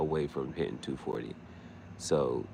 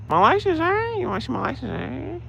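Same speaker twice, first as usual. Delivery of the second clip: much higher, louder mock-innocent squeak; silly high voice; 9 dB louder than usual. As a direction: dodge the cop in a goofy squeal. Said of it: "change your voice up".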